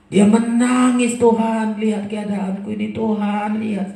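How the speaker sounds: high, loud pleading prayer; tearful plea. Acted. Hezekiah weeping to God, loud pleading. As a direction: beg God to look.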